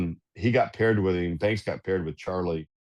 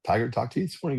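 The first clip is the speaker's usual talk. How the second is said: retold surprise; 6 semitones higher, faster than usual. Acting out his surprise at news.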